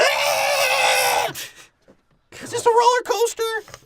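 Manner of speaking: put-on a teen screaming on a roller coaster; screaming teenager.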